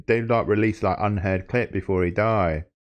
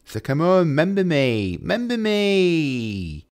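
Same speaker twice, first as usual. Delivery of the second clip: sing-song plea; 8 semitones higher, slower than usual. Acted. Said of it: "begging for members".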